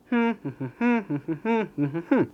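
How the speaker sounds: soft hummed beat, strong and weak pulses; rhythmic humming. Shown as a demonstration. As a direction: hum out the stress rhythm softly. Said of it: "একদম rhythm maintain করে". (তালে তালে গুনগুন)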